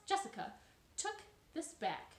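usual speaking voice

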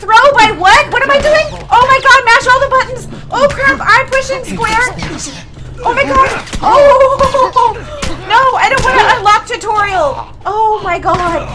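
high, loud frantic shrieking; panicked yelling. Real, not acted. Gamer mashing all the buttons under attack, panicked yelling. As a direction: yell in a flustered panic, voice way up.

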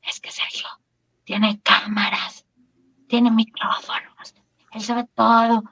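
whispering like a frightened secret; soft, drawn-out conspiratorial whisper; hushed imitation. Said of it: "que hablaban así".

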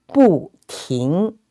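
"不" falls, then "停" rises; fourth-tone bu. Shown as a demonstration. Teacher giving a phrase to repeat, "不" falling, "停" rising. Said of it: "bu, with the fourth tone".

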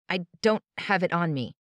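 choked out. Acted. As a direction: force the words out through fear. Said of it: "I choked out".

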